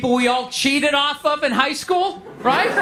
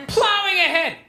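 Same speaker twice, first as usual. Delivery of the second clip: high-pitched announcement, falling at the end; pushing on; 6 semitones higher than usual. Real, not acted. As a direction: sing out the move onward up high. Deliberate reset, then repeated in usual voice.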